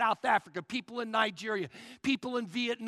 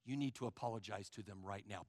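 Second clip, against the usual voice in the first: low, soft and quick; a small, hushed nudge from within; tiny inner voice; 12 semitones lower, 12 dB quieter, faster than usual. Acted. God nudging a person to apologize, tiny quiet voice. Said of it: "you heard this tiny voice".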